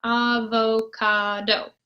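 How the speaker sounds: avocado slow, each of four syllables spaced. Shown as a demonstration. Teacher counting syllables slowly. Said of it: "Four syllables in avocado".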